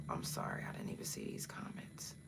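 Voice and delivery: hushed whisper to the chat; sudden breathy whisper, barely voiced. Real, not acted. Host whispers to viewers while reading comments, hushed.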